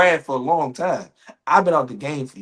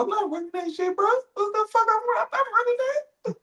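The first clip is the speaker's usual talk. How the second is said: very high falsetto protest; comic high-pitched objection; squeaky refusal; 4 dB quieter than usual. Acted. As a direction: refuse the play in a squeaky panic. Acted player refuses the play.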